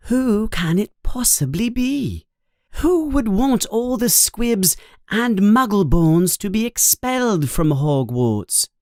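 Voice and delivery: put-on high-pitched, wondering girlish voice; puzzled schoolgirl. Voices Hermione wondering aloud in story.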